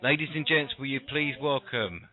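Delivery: ladies-and-gents stage introduction with dramatic pauses; announcer introduction. Acted. Introduces the guest like a showman.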